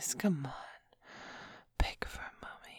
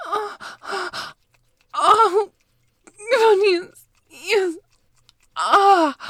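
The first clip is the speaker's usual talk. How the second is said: rising to much higher, much louder cries; climax cries; 10 semitones higher, 13 dB louder, slower than usual. Acted. Woman crying out at the climax of the scene. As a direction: let it peak into full-throated cries.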